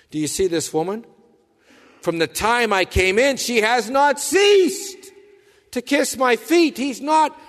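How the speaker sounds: high, loud impassioned preaching; fervent preacher painting the scene; preaching at full force. Acted. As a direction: build the story with rising fervour. Preaching builds again.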